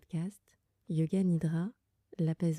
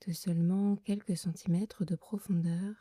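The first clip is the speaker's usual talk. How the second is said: guided meditation. Acted. Leads a Yoga Nidra meditation. (voix douce et lente de méditation guidée)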